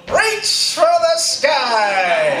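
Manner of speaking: high, very drawn-out doll catchphrase; Woody. Retold or imitated. Couple hears the doll from downstairs, high slow cowboy call. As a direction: stretch it out like a cowboy toy calling. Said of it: "we both heard Woody go".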